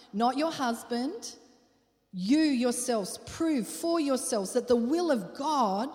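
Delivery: slow, loud stress on "you"; emphatic preaching. Acted. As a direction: drive home each "you" slowly.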